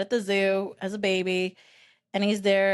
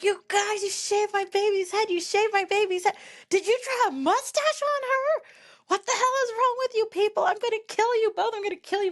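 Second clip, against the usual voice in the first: mock furious yelling; 13 semitones higher than usual. Mom confronting dad and uncle over the shaved head, mock furious yelling. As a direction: imitate a mother's shrieky tirade at a very high pitch. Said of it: "Yelling and cursing".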